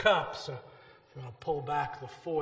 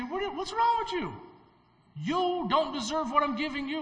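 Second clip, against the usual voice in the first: a much higher, stern rebuke as the Lord; the Lord rebuking; 10 semitones higher than usual. Acted. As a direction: voice God's sharp disappointment in us. Preacher voices the Lord rebuking.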